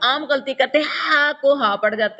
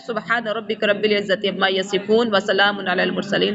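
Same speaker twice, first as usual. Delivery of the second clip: Quran recitation; 3 semitones lower than usual. Acted. (آہستہ تلاوت کا انداز)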